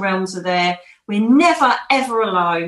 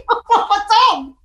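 high squeal through a laugh; 12 semitones higher, 5 dB louder than usual. Real, not acted. To the interviewer, recalling her fright: laughing high. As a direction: laugh out the question at your own fright.

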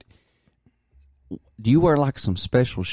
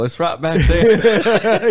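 laughing; 6 semitones higher, 5 dB louder, much slower than usual. Real, not acted. Joking about the cliff.

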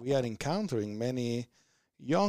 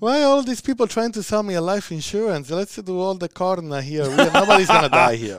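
loud, high protest through laughter; mock-indignant Italian; 7 semitones higher, 12 dB louder than usual. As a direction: play the affronted refuser, raised and loud. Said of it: "because Italians say".

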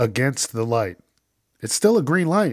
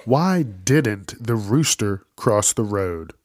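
usual speaking voice

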